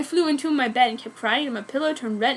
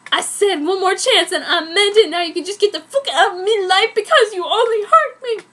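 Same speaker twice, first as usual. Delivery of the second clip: louder, higher, half-laughing anger; laughing, tearful fury; 7 semitones higher, 7 dB louder than usual. Acted. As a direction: throw him out of her life. Tearful angry breakup line.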